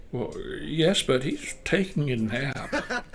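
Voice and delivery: soft, hesitant mother's reply; lightly voiced mother, slow and quiet at the end; mother on phone. Old mother answering a caller at home, softly voiced. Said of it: "My mother replies".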